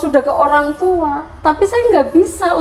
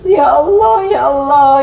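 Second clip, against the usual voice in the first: crying call to God; 3 dB louder than usual. Retold or imitated. Mother recalling calling to God, calling out tearfully. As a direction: call his name again and again, weeping. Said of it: "saya panggil Allah terus menerus".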